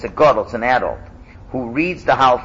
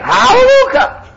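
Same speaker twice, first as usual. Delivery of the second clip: very high, loud Hallelujah; congregation shout; 9 dB louder than usual. Shown as a demonstration. Teacher voices the crowd answering every clause. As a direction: belt it out like the crowd. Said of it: "and people are just saying".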